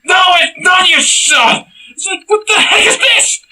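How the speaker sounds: very high, loud outraged yelling, cracking with laughter; furious shouted rant. Real, not acted. Ranting that the film's music is not rock, loud high yelling. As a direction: scream it in comic outrage.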